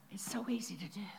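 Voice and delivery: soft whispered aside. Real, not acted. Preacher confiding to the congregation in a whisper. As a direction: breathe it quietly to the congregation. Aside after the retold call.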